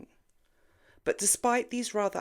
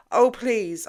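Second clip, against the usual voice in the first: amused scoff; 6 semitones higher, 10 dB louder than usual. Acted. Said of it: "Jenny burst out laughing".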